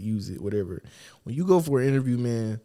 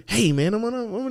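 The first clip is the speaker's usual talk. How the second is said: mock fake sweet talk; 8 semitones higher, faster than usual. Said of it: "give me this fake ass".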